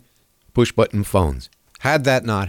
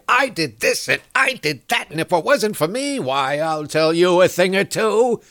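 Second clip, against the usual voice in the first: higher, boastful mock politician voice; mocking Hillary; 5 semitones higher than usual. Radio host mocking Hillary bragging to a crowd, high boastful mock voice. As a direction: puff it up like a self-congratulating candidate. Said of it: "like she would and say".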